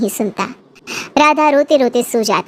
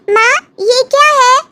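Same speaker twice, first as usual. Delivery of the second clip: put-on curious little girl; 11 semitones higher, 4 dB louder than usual. Small daughter asks mother.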